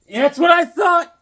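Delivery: high, loud excited yell; excited shout. Real, not acted. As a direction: burst out thrilled at the find. Excited at wraparound cover.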